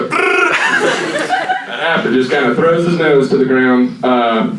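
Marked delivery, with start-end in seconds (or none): 0.0-2.1 woodpecker noise. Acted. Woodpecker noise over words.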